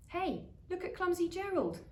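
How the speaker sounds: high jeering taunt; mocking taunt. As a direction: sneer like a playground bully. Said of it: "the animals all laughed".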